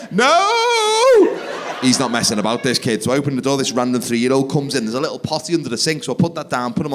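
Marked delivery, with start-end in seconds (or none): 0.1-1.4 very high, drawn-out "no"; desperate child's wail. Retold or imitated. Child outside who needs a poo, desperate mock wail. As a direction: wail it like a bursting toddler.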